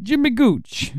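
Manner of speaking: lightly voiced gangster; a hint of a tough mobster; mafia voice. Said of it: "I use my mafia voice".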